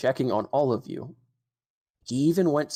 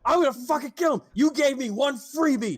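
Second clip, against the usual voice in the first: higher, louder raging threat; furious ranting; 10 semitones higher, 4 dB louder than usual. Acted. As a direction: spit out the fury about the freebies. GM voices furious Garum.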